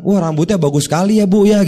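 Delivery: over-sweet flattery that sounds like mockery; fake compliment. Shown as a demonstration. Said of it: "Itu kan kayak menghina". (pujian basa-basi yang berlebihan)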